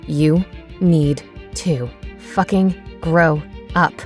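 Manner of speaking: slow, deliberate, seething; cold anger. Acted. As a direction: grind each word out slowly. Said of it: "Anger gripped firmly now".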